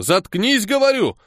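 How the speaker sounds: high, heated shut-up; exasperated command; angry order. A narrator's reading. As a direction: snap at him to stop, pitch jumping. Friend silences a drunken singer.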